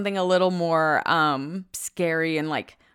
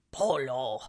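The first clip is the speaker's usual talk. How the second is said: low, soft imitation of an eerie voice; imitated trailer voice; 8 semitones lower, 8 dB quieter than usual. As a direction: mimic the voice from the trailer, low and hushed. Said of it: "When that voice says".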